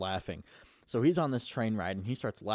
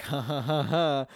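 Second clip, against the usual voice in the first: higher, louder fake ha-ha laugh; imitated laugh; 4 semitones higher, 5 dB louder than usual. Character reacts to the gun mime, mock laughing.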